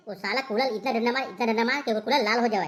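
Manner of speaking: usual speaking voice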